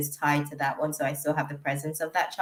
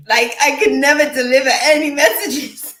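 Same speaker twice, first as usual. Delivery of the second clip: loud, high, laughing through the words; 8 semitones higher, 9 dB louder than usual. Real, not acted. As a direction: giggle out the memory. Laughing about past crying.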